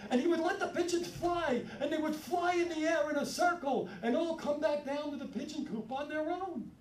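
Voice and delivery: usual speaking voice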